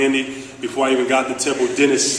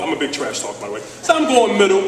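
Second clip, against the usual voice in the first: ordinary talk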